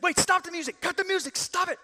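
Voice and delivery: high, loud cry to stop the music; frantic shout. Retold or imitated. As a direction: yell at the orchestra to cut it. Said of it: "He's like".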